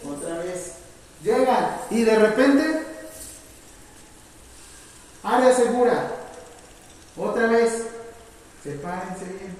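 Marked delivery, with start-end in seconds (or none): 1.2-6.1 loud, slow, higher call; energetic instructor's safety call. Shown as a demonstration. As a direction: announce the safe area with force. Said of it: "Las quiero enérgicas".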